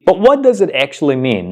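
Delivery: normal talking voice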